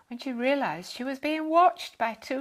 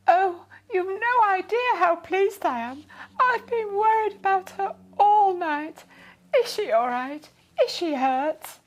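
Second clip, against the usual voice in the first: high, loud and breathless with relief; relieved fussy lady; 9 semitones higher, 9 dB louder than usual. A narrator's reading. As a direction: gush out the relief, then anxious questions. Relieved lady asks about her cat.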